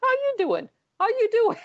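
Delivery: squeaky high repeated greeting; sing-song high "how you doing"; mimicking greeting. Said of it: "doing the "How you doing" back and forth".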